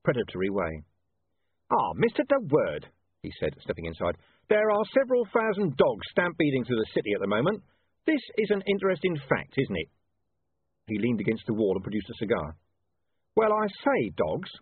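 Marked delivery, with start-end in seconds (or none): 1.7-2.9 slow, menacing smiling drawl; a humourless, predatory greeting; predatory smile. Acted. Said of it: "smiling in a humourless, predatory way". 4.5-9.9 dry, conversational menace; heavy irony, calm; ironic commander. Acted. Vimes ironic.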